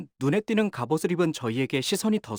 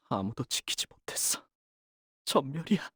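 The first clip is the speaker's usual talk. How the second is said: hollow despair; 6 dB quieter than usual. Acted. Mission failure despair.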